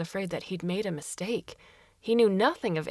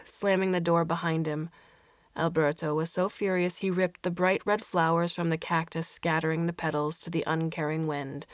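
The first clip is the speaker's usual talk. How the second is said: ordinary delivery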